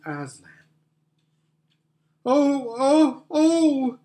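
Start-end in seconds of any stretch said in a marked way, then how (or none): 2.2-4.0 high, loud wail of dismay; anguished cry. Acted. Girls cry out on finding Aslan gone, anguished. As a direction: cry out in distress, pitched right up. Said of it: "cried the girls".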